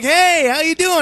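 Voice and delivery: mock-chirpy old buddy on the phone; old friend calling. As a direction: overeager friend calling out of the blue. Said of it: "He goes like".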